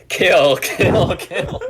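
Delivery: high, laughing chant of kill; giggling menacing chant; Doctor Lulu voice. Retold or imitated. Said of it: "Doctor Lulu's voice in my head".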